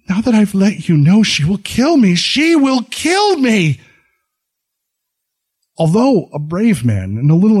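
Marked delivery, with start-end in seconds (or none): 0.1-2.2 she will kill me, rising in terror; agony of terror. A narrator's reading. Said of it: "adding in an agony of terror". 2.2-3.8 "kill" much higher, slow and desperate; rising terror. A narrator's reading. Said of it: "adding in an agony of terror".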